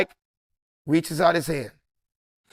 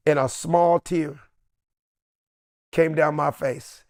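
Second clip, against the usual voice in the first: ordinary talk, nothing marked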